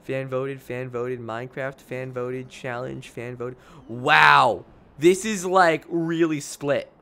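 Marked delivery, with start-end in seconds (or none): 0.0-3.6 counting votes under breath; softly tallying to himself; muttering. Real, not acted.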